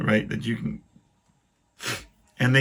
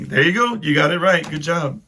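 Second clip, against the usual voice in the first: higher, louder teasing cheer; mock praise; 6 semitones higher, 5 dB louder than usual. Mocking the spelling-bee girl with overdone praise. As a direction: cheer her on sarcastically.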